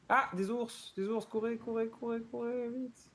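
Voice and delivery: high squeaky voice urging to run; hammy fearful cries to flee; mock panic.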